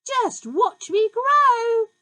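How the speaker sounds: high, loud gleeful whoop; shouted whoopee. A narrator's reading. Grandma shouting with joy as she rises. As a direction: whoop it with wild glee.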